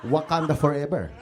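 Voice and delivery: put-on indignant Filipino uncle with a thick accent; Tito Chito. Uncle defending himself to his relative, indignant Filipino-accented character.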